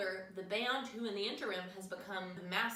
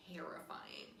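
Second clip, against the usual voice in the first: hushed, creepy hiss on the word; hushed horror-story whisper; spooky whisper; 10 dB quieter than usual. Acted. Spooky recap of horror scene.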